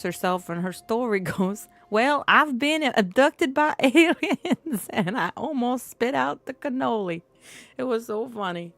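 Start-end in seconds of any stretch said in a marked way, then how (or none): 1.9-7.2 higher, slow, mock accent quote; imitating a Don's accent. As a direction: ham up the Don's accent while retelling her intro. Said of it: "I can't do the accent".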